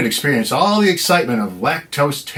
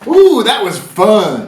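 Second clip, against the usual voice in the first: gleeful; 7 semitones higher, 7 dB louder, slower than usual. Acted. Gleeful host exclamation.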